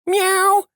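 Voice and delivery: very high-pitched meow; cat meow. Acted. Pilots messing about on the guard frequency, cat meow. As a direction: squeal out an imitation cat meow. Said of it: "pilots making cat sounds".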